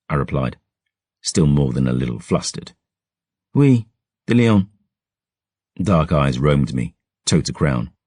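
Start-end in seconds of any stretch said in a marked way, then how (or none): 3.5-4.7 put-on French-accented noble girl. Astrid repeats Gabriel's name, higher, with French flair. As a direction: echo his name with a French lilt.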